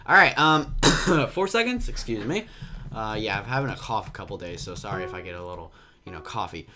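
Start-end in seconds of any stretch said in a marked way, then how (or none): none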